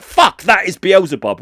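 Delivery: hammed-up startled exclamation; mock astonishment. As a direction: react as if the devil just appeared. Imagined reaction to demon appearing.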